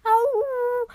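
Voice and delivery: long mock wolf howl. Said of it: "Howls are like".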